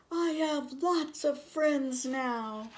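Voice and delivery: a distant echo of the shout, slow. A narrator's reading. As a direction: call it back like an echo from afar. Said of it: "a voice answered him".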